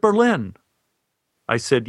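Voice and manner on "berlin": insistent. Retold or imitated. Man insists loudly.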